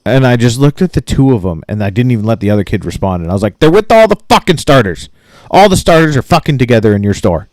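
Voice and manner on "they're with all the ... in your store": retold angry outburst, higher pitched at first; fed-up customer setting the clerks straight; exasperated outburst. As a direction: re-enact losing patience with clueless store clerks. Snapping at two store clerks.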